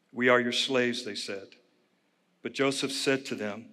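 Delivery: soft, measured Bible reading; scripture reading. Acted. Pastor reading Genesis aloud to the congregation, soft and measured. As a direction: read the passage quietly to the congregation.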